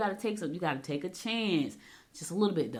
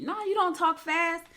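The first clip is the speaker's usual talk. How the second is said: high-pitched mimicked sister; much higher, put-on sibling voice; imitating her sister; 12 semitones higher than usual. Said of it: "One of my sis-, sisters said".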